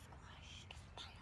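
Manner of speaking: breathy spooky whisper. Shown as a demonstration. Teacher whispering the story title for suspense. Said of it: "repeated title in whisper".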